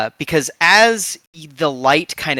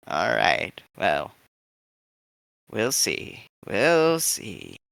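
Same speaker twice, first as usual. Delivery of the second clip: put-on much lower and softer, amused old woman; low chuckling old lady; 4 semitones lower, 9 dB quieter, slower than usual. Old fixer sizing up the party, low and soft.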